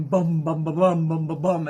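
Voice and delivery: booming drum sounds, laughing at the end; mimicking pounding war drums; war drum beat. Shown as a demonstration. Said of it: "the war drums are going".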